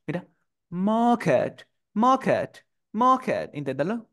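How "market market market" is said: "market" con A larga y sin R, repetido como modelo; market británico. Shown as a demonstration. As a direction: modela "market" alargando la vocal, R muda. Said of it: "no pronuncio la R y la tengo que hacer alargada". ("market" a la británica, vocal sostenida)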